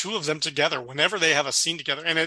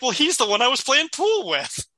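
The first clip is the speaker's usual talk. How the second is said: a higher, naive Woody voice; innocent Woody; 9 semitones higher than usual. Retold or imitated. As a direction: answer like the simple, oblivious Woody. Woody answers innocently, retold.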